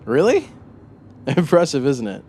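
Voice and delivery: high, loud, gleeful surprise; thrilled someone noticed his work; delighted. Acted. Killer delighted by his studio.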